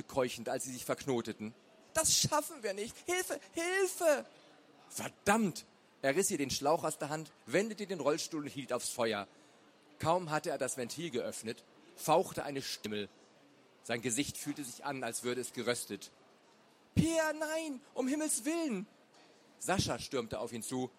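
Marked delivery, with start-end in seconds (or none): 1.9-4.3 very high, frantic calling; panicked cry for help. A narrator's reading. Neighbour losing hope as the fire spreads, panicked cry. 4.9-5.7 urgent curse. A narrator's reading. Peer curses at the fire. 16.9-18.9 horrified cry. A narrator's reading. Wife rushing towards husband at the fire, horrified cry.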